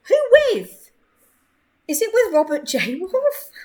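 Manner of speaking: can't believe who it is; surprised squeal. Real, not acted. Streamer spots her boss's sim, surprised squeal.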